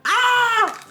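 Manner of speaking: sudden very high-pitched squeal; startled, sky-high yelp. Real, not acted. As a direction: let out a piercing little "ah". Startled squeal while opening product.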